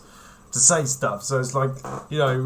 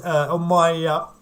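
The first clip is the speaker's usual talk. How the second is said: ordinary delivery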